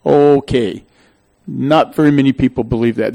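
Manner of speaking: ordinary talk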